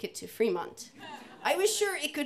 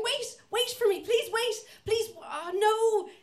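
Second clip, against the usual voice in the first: much higher, slow pleading wail; panicked plea; 11 semitones higher, 3 dB louder, slower than usual. Retold or imitated. Stranded cyclist calling after the group, panicked plea. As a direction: call after them in comic panic.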